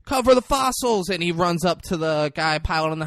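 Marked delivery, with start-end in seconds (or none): 0.1-1.1 high, urgent imitation of panic; retold frantic command, pitched up; mock freak-out. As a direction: call out orders in pretend panic. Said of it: "they're freaking out".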